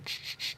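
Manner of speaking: radio static. Retold or imitated. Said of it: "you say".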